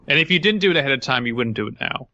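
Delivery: normal talking voice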